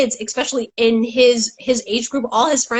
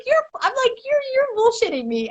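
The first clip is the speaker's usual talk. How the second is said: high teasing voice; 11 semitones higher than usual. Retold or imitated.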